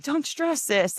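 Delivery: lightly voiced stranger calling her sis; stranger's comfort. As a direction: soothe her like a sister. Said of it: "he was like".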